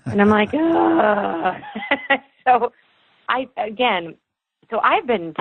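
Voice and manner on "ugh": exasperated ugh. Real, not acted. Mom reacting to son's remark.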